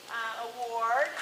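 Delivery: ordinary talk, nothing marked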